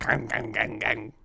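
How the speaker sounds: repeated mouth-made twang noise; imitated twanging noise. Friend describing cat floss video, imitated twanging noise. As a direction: mimic floss plucked on teeth. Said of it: "it's just like".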